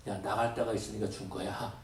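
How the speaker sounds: low, soft reassuring answer; gentle voice of God. Retold or imitated. God answering the preacher's complaint, low and gentle. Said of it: "라고 말씀하시더라고요".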